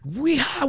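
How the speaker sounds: high-pitched drawn-out karate yell; mock martial-arts whoop; imitated karate cry. Witness showing the kicks through the car, karate cry. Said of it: "he was going".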